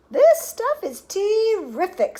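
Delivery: high, loud, drawn-out delight; gleeful relish. A narrator's reading. Augustus gulping chocolate from the river, gleeful relish. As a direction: stretch it out with greedy relish.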